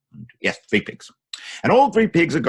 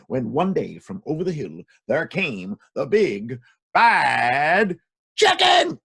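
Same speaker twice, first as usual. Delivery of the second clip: dramatic build-up; 3 dB louder, slower than usual. Acted. Storyteller announcing the villain with a big shout. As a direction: drag it out and burst on the last word.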